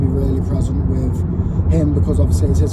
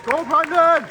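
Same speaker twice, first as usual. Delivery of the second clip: cheering shout; 14 semitones higher than usual. Real, not acted. Cheering from crowd at show.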